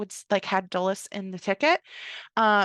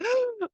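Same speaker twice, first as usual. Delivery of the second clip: sharp gasp; 14 semitones higher than usual. Retold or imitated. Kindle handed back unexpectedly.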